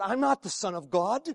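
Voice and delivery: ordinary talk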